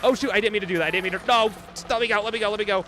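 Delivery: loud, high frantic apology; panicked pleading. Real, not acted. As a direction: yelp to be let go. Grabbed after running past enemies.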